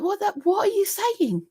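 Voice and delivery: high mock-baffled "what are you saying". Bewildered by Tanny's Yorkshire accent, high baffled. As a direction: act totally lost by the accent. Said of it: "I was like".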